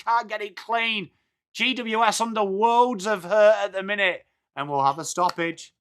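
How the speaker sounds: flowing match call, rising in pitch; live play-by-play. Real, not acted. Streamer calling Sydney's running play for viewers, live commentary. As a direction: follow the ball from player to player, lifting as it builds.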